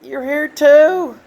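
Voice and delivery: high tender welcome in heaven; Ray greeting the dog. Pretend. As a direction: greet the old dog like a long-lost friend. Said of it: "picked her up and says".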